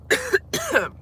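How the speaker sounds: a sharp, dry cough from a sore throat; real cough; coughing fit. Real, not acted. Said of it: "I can't talk".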